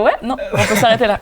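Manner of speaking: real giggles breaking in; laughing. Real, not acted. Interviewer wrapping up the segment, laughing.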